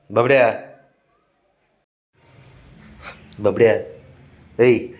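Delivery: loud, short calls of a name; calling out. Real, not acted. Man calling his sulking pet, calling out. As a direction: call the pet's name loudly to get attention.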